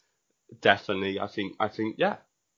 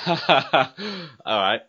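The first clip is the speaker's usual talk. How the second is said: ordinary talk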